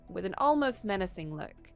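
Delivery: plain reading voice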